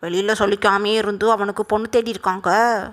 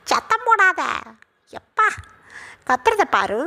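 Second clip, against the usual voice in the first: high-pitched, exasperated hushing; scolding grandma; 9 semitones higher than usual. Acted. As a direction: hush her with mock exasperation, pitch raised. (உயர்ந்த குரலில் பாட்டியின் அதட்டல்)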